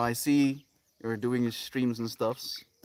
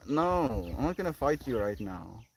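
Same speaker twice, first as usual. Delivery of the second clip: usual speaking voice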